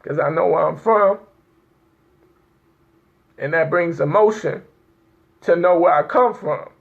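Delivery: real, restrained crying talk; tearful. Real, not acted. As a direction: overcome by emotion, with long pauses to hold it together. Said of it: "why I'm crying right now".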